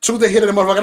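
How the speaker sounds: ordinary talk, nothing marked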